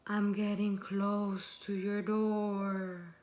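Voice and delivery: slow, drawn-out haunting menace; creepy voice. Acted. Creepy voice closing in on the girl's door, slow and eerie. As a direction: stretch each word like an approaching ghost. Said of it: "And the voice is like".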